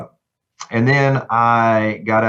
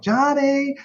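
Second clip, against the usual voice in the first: singsong mom holler, pitched way up without real volume; imitated mom's yell. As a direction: call a child in for dinner like a mom. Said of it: "Your mom would come and yell like".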